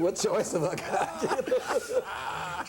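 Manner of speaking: slow, high laughing shrug; laughing resignation. Real, not acted. Telling the interviewers his reply to [name], laughing. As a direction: laugh it off, what choice.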